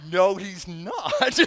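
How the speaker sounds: drawn-out denial breaking into laughter; slowly contradict, then burst out laughing; amused, real laugh on the denial; laughing rebuttal. Real, not acted. Laughing at a glib phrase.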